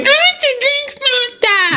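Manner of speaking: put-on very high, slow baby voice. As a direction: sing it like a small child. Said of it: "playing with a baby voice".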